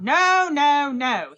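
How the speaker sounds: loud angry no; shouting. A narrator's reading. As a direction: bark it out, refusing. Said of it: "he shouted".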